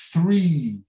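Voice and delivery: Three repeated with a drawn-out ee; long I sound. Shown as a demonstration. Teacher repeating Three for the class, long ee. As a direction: hold the ee of Three and let it fall. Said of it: "palavras têm este som".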